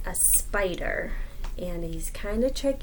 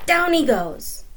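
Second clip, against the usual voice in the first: higher, louder gleeful line; 8 semitones higher, 6 dB louder, slower than usual. Acted. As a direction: relish the trick. Dramatic punchline.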